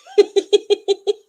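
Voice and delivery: high, loud run of giggles; real giggle. Real, not acted. Streamer amused by the chat, high giggle. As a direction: burst into rapid high giggling.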